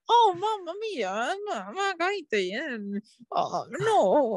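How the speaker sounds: high, hammed-up Mario-style Italian accent. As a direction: put on a cartoonish, high-pitched Italian accent. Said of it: "stereotypical I-, Italian accent".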